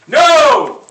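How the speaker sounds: hammed-up customer shouting no at the waitress; mock shout. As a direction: yell no like a stuffed diner. Customer refusing dessert, acted.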